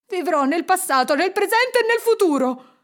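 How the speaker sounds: high, loud elation; jubilant vow. A narrator's reading. Scrooge leaps from bed, exultant. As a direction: proclaim it with overflowing joy. Scrooge wakes redeemed, vows joyfully.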